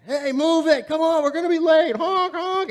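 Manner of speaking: hammed-up impatient drivers honking. Drivers stuck behind the stalled truck, mock yelling.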